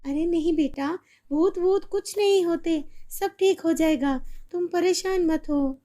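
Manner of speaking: put-on calm the child down; mother calming her scared daughter; reassuring mother.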